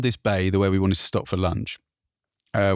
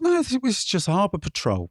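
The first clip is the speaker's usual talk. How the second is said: patrol officer; 9 semitones higher than usual. Retold or imitated. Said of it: "He was like".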